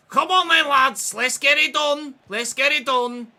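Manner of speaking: loud, high Scouse rallying cry; rally the lads loudly in a put-on Scouse accent. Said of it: "I won't do the Scouse accent for longer than just the intro".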